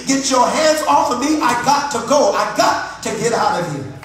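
building from steady resolve to loud demand; defiant sermon cry, gaining volume; preaching plea to devil. Acted. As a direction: preach it with rising force, defiant.

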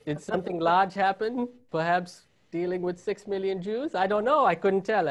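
mock-innocent Germans playing dumb in a German accent. Comedian mocking German authorities in a German accent. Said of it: "the best German accent you guys are gonna get out of me".

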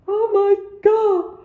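high, loud frightened cry; a sudden real jolt of fear; real fright. Real, not acted. Startled by the shape under the bed.

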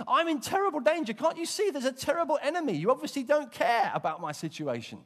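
high, loud whining plea; panicked complaint. Pretend. Preacher mocking a frightened believer, high whining complaint.

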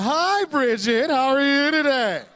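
over-the-top greeting. Retold or imitated. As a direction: lay the friendliness on thick for Bridget. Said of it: "I'm over the top".

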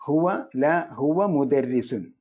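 ഹുവ said clearly with weight, the rest slow; slow and deliberate model sentence for students; slow teacher model, ഹുവ stressed. Shown as a demonstration. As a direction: carefully spell out ഹുവ for the class. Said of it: "ഹുവ എന്ന സർവനാമം ഉപയോഗിക്കുക".

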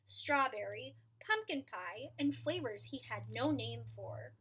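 plain reading voice

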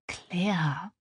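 soft whispered name; tröstend. Acted. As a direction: say the name gently, almost without voice. Freundin tröstet die Weinende. (sanft geflüstert)

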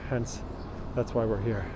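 ordinary delivery

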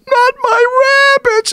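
wife protests from the back, high; wife's cry. Retold or imitated. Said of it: "you hear my wife in the back".

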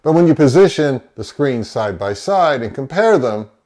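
usual speaking voice

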